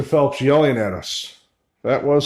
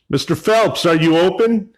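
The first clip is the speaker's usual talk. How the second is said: raised, higher questioning call; 4 semitones higher, 4 dB louder than usual. Real, not acted. During a voice recording, asking Mister Phelps if he's open, calling out. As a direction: call out to an unseen shopkeeper.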